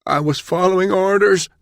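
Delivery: high-pitched pained plea; agonized gasp. A narrator's reading. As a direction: gasp it out in agony. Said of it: "the prisoner gasped".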